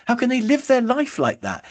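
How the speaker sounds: high-pitched mock exasperation; exasperated analytical. Analytical thinking about a disorganized enthusiast, exasperated. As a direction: imitate a baffled analytical, pitch climbing as it builds. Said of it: "find them a bit of a nightmare".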